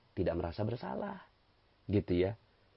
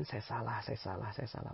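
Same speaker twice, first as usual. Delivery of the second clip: softer and fading, repeated admission; humble admission; 2 semitones lower, 11 dB quieter than usual. Shown as a demonstration. Preacher showing the congregation how to admit fault, softer and fading. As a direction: murmur it humbly, trailing away. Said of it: "selalu mengucapkan".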